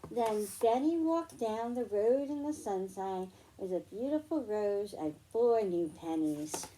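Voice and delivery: usual speaking voice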